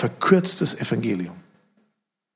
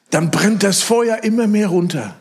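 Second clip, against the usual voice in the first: church lady quote; 7 semitones higher, 5 dB louder than usual. Retold or imitated. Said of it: "Sagt sie".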